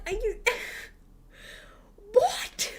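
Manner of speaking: high, breathy whispered what with a laugh; baffled disbelief. Retold or imitated. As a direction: voice jumps up in stunned confusion. Baffled by gravestone photos message.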